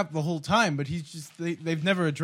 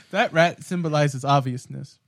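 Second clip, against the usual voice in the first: normal talking voice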